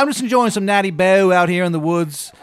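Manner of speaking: put-on loud, high, terrible Maryland accent; Baba Yaga. Baba Yaga with her beer, loud bad Maryland accent. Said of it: "She's got the shittiest Maryland accent".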